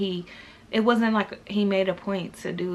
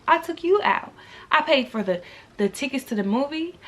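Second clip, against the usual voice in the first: usual speaking voice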